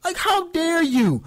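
high indignant "what, how dare you"; a fan hearing the news, shrieking in disbelief; shocked outrage. Retold or imitated. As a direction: act stunned and offended. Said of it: "I'm like".

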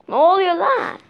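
sudden high startled cry of what is happening; startled outburst. Real, not acted. Kid yelps at his glitching tablet, startled and loud. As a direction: yelp in alarm at the glitching tablet.

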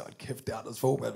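low, soft, hushed; hushed awe. Retold or imitated. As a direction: say it under your breath while staring in. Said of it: "vi siger".

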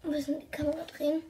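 quick hushed whisper. Real, not acted. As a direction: whisper it fast under your breath. Adjusting camera quietly.

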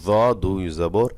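very slow, every letter drawn out; slow careful recitation. Shown as a demonstration. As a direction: recite it slowly for students to copy. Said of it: "এভাবে আস্তে আস্তে পড়বেন".

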